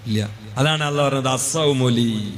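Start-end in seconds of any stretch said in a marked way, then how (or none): 1.3-2.3 low, slow sacred quote; drawn-out recitation. Acted. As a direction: stretch the last syllable, low and measured. (പതിഞ്ഞ് നീട്ടിയ പാരായണം)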